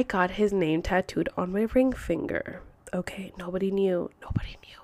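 whispered secret aside. Real, not acted. Telling viewers nobody knew about the tattoo, whispering. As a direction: drop to a whisper like sharing a secret.

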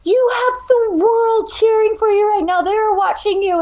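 rally her like her biggest cheerleader; husband cheering. Retold or imitated. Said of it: "and he's like".

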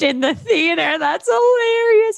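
reading through rising laughter into a high squeal; breaking into laughter. Real, not acted. Co-host cracks up reading a listener's comment, laughing. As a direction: lose it laughing, pitch climbing high. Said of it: "I'm not laughing".

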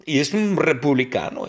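usual speaking voice

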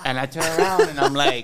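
high, loud, worked-up telling; excited retelling. Real, not acted. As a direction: pitch it up in disbelief. Retelling the shock, voice up.

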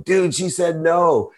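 drawn-out, high mock yell. Friend announcing a rejection in front of everyone, yelled out. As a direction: call it out slowly for all to hear. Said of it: "he yelled out in front of everyone".

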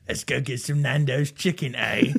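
hammed-up British accent; comic British caricature; mock British accent. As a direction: put on a cheeky fake British accent. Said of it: "why are you talking like that?".

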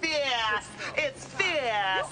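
high, breathy sassy exclamation; sassy drag queen. Acted. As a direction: purr it like a fierce queen.

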